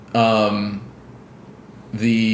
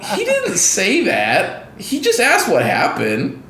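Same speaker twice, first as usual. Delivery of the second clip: high and slow, laughing through the words; can't believe what she just said, laughing it out; laughing disbelief; 9 semitones higher, slower than usual. Retold or imitated. Reacting to Tifa's outburst in the game, laughing disbelief.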